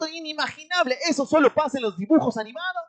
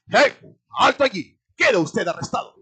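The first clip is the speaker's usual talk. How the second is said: police order; 5 semitones lower, 5 dB louder than usual. Retold or imitated. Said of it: "lo que hace la policía es".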